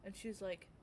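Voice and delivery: ordinary talk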